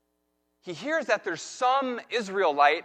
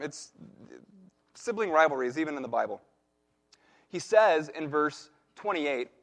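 ordinary talk

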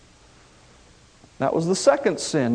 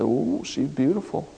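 lustful king; 6 semitones lower, 6 dB quieter than usual. Retold or imitated. David ogling the bathing woman.

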